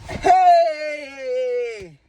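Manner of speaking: long drawn-out "hey"; loud, elongated mock greeting; intrusive coworker call. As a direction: call out, stretching the word. Acts coworker intruding on her.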